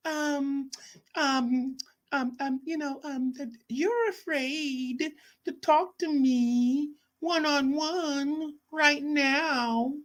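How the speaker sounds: high, slow mocking voice of Frank; high-pitched mock impression of a rival. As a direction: imitate him in a high sneering whine.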